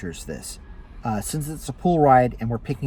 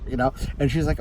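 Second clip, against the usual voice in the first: ordinary talk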